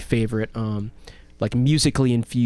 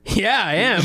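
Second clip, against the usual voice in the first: higher, chuckling through the words; laughing reply; 9 semitones higher, slower than usual. Retold or imitated. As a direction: answer with an amused laugh. Celebrity's amused reply retold.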